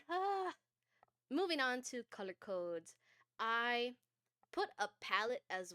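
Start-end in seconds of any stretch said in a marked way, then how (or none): 0.1-0.5 embarrassed cry. Real, not acted. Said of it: "what am I doing?".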